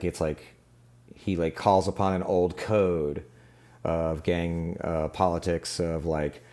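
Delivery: ordinary talk, nothing marked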